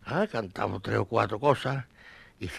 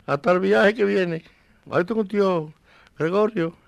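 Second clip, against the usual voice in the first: hearty goodbye; 8 semitones higher, 6 dB louder than usual. Retold or imitated. Viudo se despide de ellos.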